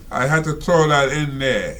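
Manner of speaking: normal talking voice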